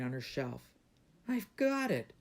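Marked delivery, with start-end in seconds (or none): 1.3-2.1 high-pitched eureka cry; excited shout. A narrator's reading. Princess suddenly finds her plan, excited shout. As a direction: exclaim it, pitched way up. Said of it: "she shouted".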